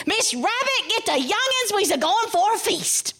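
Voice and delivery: high-pitched excited call; rally the family in a high, quick-spirited voice; high storybook rabbit calling out. Acted. Brer Rabbit calls family to feast.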